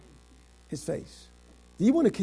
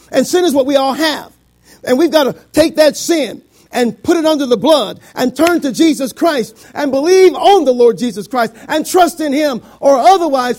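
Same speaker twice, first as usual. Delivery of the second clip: loud, raised, driving sermon delivery; full-force preaching; 6 semitones higher, 11 dB louder than usual. Real, not acted. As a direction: press the warning on the congregation.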